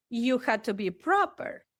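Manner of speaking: normal talking voice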